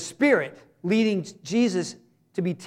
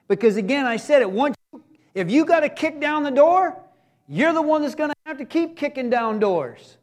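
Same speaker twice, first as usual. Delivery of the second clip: normal talking voice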